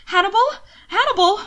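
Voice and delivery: high, loud frantic calls; calling out. A narrator's reading. Will calling to Hannibal in the water, frantic shout. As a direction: shout his name over the water.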